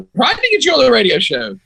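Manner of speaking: high, pushed indignant question; heated question. Retold or imitated. Radio host retelling how he snapped at the sideline reporter, heated and indignant. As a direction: fire it back, fed up and hot. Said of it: "I was hot".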